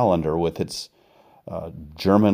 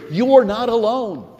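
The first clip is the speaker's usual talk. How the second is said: ringing assurance; 4 dB louder than usual. Acted. Preacher's emphatic reassurance.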